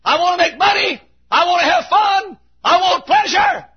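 very high, demanding shout; acted high-pitched demands; shouting back. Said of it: "Shallow shouts back and says".

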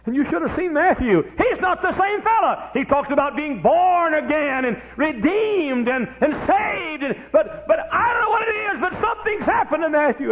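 high, loud hollered sermon lines; preaching at full force. Acted. Preacher hollering to the church about Matthew. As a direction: preach it out to the congregation.

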